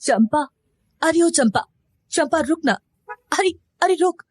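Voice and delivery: anxious mother calling after her daughter. A narrator's reading. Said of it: "उस पगली को आवाज देते हुए".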